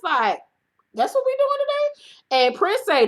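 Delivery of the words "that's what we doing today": high, disbelieving squeal; high shocked retort; incredulous falsetto. Retold or imitated. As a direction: jump up high, appalled at what you heard. Host's disbelief at homophobic remark.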